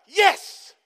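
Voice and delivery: high, loud yelp of joy; excited shout. Real, not acted. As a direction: cheer them on with a shout. Preacher cheers congregation's answer.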